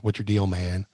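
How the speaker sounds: imitated catchphrase. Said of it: "his first line on the show is".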